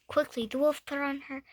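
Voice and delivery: ordinary delivery, nothing marked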